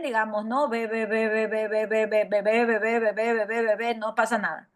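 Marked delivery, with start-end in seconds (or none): 0.7-3.9 BE chained on a monotone with loose lips; repeated bebe. Shown as a demonstration. Teacher shows it works with e, chained fricative b. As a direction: rattle off BE nonstop, air still flowing. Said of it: "O bebé también".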